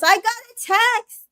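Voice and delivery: hammed-up high call announcing a text; imitated yell. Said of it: "then she yells".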